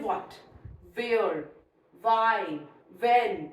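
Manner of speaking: "what, where, why, when" with a plain W, spaced apart; silent H. Shown as a demonstration. Teacher lists WH examples, "what, where, why, when" without H. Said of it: "H is silent, only W is voiced".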